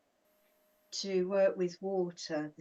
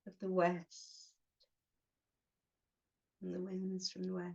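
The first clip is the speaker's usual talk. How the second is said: short phrases with long pauses between; unhurried meditation guide, giving each phrase room to settle; guided meditation. Acted. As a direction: guide them gently inward, phrase by phrase.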